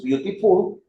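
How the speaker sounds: British English with a crisp t in "beautiful"; "beautiful" pronounced British, t clearly released; British accent. Shown as a demonstration. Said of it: "si yo la pronuncio en inglés británico".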